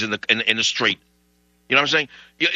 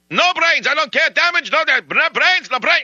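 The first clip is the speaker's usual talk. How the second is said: put-on very high, louder Russian-accented shouting; furious Russian rant; 6 dB louder than usual. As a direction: keep screaming no brains in a Russian accent. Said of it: "he's on and on with the no brains".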